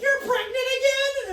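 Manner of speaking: put-on high, loud, squealing teen disbelief; shocked daughter. Mom imagines her daughter squealing at the pregnancy test. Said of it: "my daughter being like".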